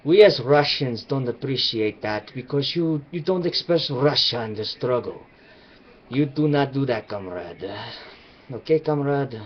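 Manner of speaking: a hint of a Russian official saying "comrade"; mock Russian. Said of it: "And they go".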